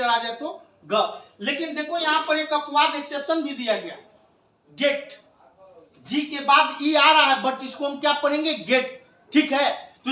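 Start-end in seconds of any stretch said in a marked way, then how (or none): none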